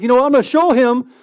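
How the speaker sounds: high, loud burst of bravado; fired-up bravado. Acted. Disciple taking on the mob arresting Jesus, fired up.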